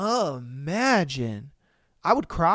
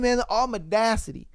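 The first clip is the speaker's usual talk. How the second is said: ordinary talk, nothing marked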